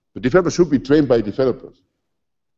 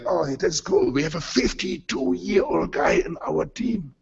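softer, slowed, gushing youngsters; mock-impressed young team; 5 semitones higher, 6 dB quieter, slower than usual. Young team marvels at a fifty-two-year-old, softly gushing. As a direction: gush over the older guy like a novelty. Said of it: "they were like".

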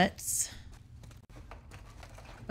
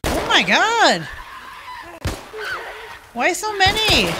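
high loud panicked yelling; panicked cries at the horde; panicking; 13 semitones higher, 7 dB louder than usual. Real, not acted. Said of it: "Sorry, I'm panicking".

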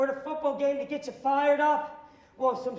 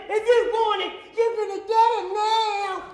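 higher, louder hammed-up vendor yell; fired-up shout; 8 semitones higher, 9 dB louder than usual. Fired-up vendor shouting at a fan ordering lemonade, loud and wild. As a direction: throw it at them with wild energy.

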